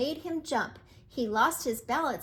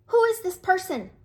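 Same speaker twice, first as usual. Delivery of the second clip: loud, high angry rooster; angry demand; 7 semitones higher, 8 dB louder than usual. Acted. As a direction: demand it like an offended boss. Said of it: "demanded the cock angrily".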